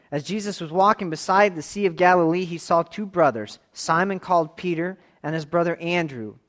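loud, high, projected Bible reading; scripture reading. Acted. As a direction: proclaim the passage out to the congregation. Reads scripture aloud to congregation.

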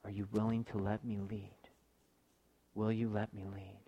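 hushed voice of God; quiet, intimate appeal standing in for a whisper; softly imitated whisper. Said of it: "he's whispering to you".

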